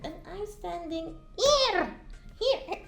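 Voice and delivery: high, stretched out complaint; mock indignation. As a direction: whine that he never looks your way. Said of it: "So I'm like".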